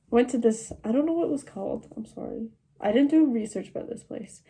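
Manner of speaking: ordinary talk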